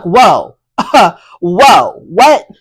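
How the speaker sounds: loud, taken-aback reaction, pitch jumping on the last word; shocked. Retold or imitated. Vlogger recounting her reaction to the apology demand, shocked. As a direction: recoil in loud disbelief, each word on its own. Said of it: "she shocked me again".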